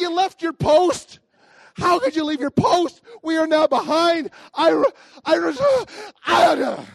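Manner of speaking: high, loud rant breaking into splutters; hammed-up fury, building until words fail; outraged outburst. Husband scolding his wife for leaving her post, spluttering outrage. Retold quarrel, ends in spluttering.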